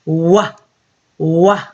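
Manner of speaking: "Wah" as a short rounded wah sound; w sound demo. Shown as a demonstration. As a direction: say "Wah" with lips rounded, twice. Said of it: "What does W say?".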